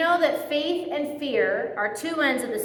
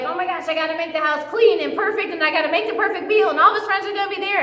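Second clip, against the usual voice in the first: fast and higher, tumbling over the words; frantic anxious rush; 5 semitones higher, 4 dB louder, faster than usual. Pretend. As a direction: rattle off the list in a mock panic. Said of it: "Can you imagine the anxiety of".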